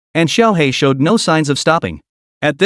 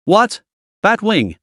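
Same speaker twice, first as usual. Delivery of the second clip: startled cry, pitch jumping up; shocked exclamation; 5 semitones higher, slower than usual. Acted. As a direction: gasp it out in disbelief.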